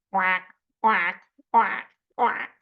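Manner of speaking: short, loud quacks; evenly spaced duck quacks; duck quacking. Acted. Teacher making animal sounds for children, quacking. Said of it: "help me with the animal sounds".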